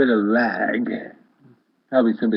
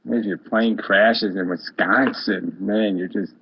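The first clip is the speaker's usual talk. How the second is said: normal talking voice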